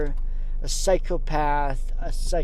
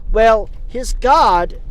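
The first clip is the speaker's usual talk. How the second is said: mock raised voice; 6 semitones higher, 6 dB louder, slower than usual. Mocking God's verdict.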